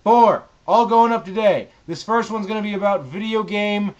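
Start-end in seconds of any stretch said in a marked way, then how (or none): 0.0-0.4 punched loud and high; emphatic. Real, not acted. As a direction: stress the single word Four. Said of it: "We got four videos today".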